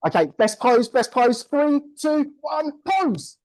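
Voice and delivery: high, climbing countdown call; excited countdown. Real, not acted. Host counts the room down to a pose, excited high call. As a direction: hype them into the final pose.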